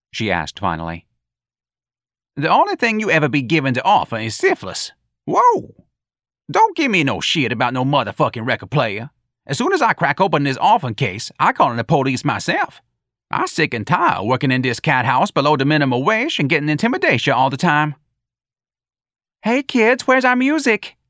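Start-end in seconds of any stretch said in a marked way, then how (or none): 2.4-18.0 high-pitched comic complainer's voice; animated, high character voice full of protest; Jones, indignant and defiant. A narrator's reading. 19.4-21.0 put-on very high, loud girlish call; high, projected, excited character voice; eager calling. A narrator's reading. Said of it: "Darlene's voice called eagerly".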